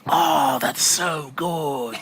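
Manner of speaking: slow, awed Aussie voice; impressed Australian drawn out; lightly done Australian accent. Retold or imitated. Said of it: "my Australian accent's not that good".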